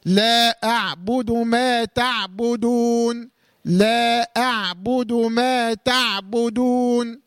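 لا أعبد ما تعبدون very slowly, the ب in أعبد sounded out clearly after the still ع. Shown as a demonstration. Tajweed teacher demonstrating the clear ba in أعبد. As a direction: stretch it out and let the ب of أعبد show. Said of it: "فبنقول إيه بقى".

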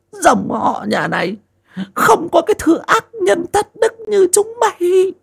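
put-on an old mother denouncing her son, high and furious; old mother's fury. A narrator's reading. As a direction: rail at her sons like a shrill old crone. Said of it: "Bà cụ Ngạc đanh mặt".

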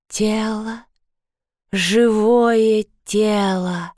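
breathy, drawn-out ghostly whisper; rustling whisper. A narrator's reading. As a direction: rustle the words slowly, like dry leaves. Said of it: "прошелестела мать".

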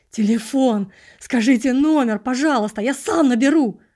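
loud desperate demand; urgent shouting. Acted. Narrator loudly demands the phone number.

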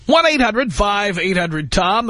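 slow, drawn-out number plug; radio announcer. Acted. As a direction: announce the phone number slowly. Radio host phone number read.

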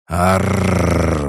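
high, loud monster roar; monstrous roar. A narrator's reading. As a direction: let out a huge beastly roar. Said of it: "взревел он".

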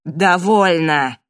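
loud, drawn-out command; near shout. A narrator's reading. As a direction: put a firm stop to the quarrel. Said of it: "почти сорвался на крик".

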